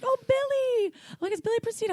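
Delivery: high-pitched oh-it's-him excitement; excited recognition. Retold or imitated. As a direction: squeal his name like you just placed him. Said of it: "I'm like".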